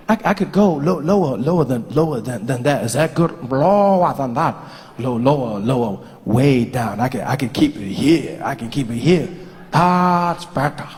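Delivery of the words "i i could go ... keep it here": dropping lower and lower. Shown as a demonstration. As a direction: sink the voice step by step down.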